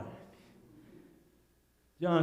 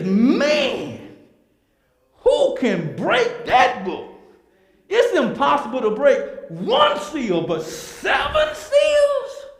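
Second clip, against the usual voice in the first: booming angel, high and loud, stretched out slow; mighty angel voice; 10 semitones higher, 8 dB louder, slower than usual. Acted. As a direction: call out like a huge angel in awe. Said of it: "and he says".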